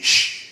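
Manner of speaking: long breath of a shush; dad's acted shush; hushing shh. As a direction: hush the listener with a firm shh. Dad hushes the teller.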